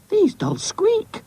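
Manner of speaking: put-on very high, slow boy's squeal; excited cry. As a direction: squeal the discovery in a child's voice. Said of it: "cried Oz".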